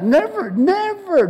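high, drawn-out preacher's "never"; emphatic never. Acted.

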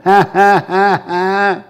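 forced, evenly spaced "ha, ha, ha, ha"; deliberate laughing. Shown as a demonstration. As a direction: laugh on purpose, one ha at a time. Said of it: "start laughing".

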